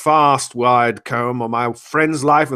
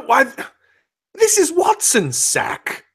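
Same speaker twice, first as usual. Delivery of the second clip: astonished; 11 semitones higher than usual. Acted. Shocked discovery.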